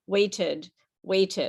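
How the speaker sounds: "waited" as wait-id, both syllables fully pronounced; two full syllables. Shown as a demonstration. Teacher models for students, "waited" in two full syllables. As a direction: sound both beats of "waited". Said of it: "fully pronounce the two syllables".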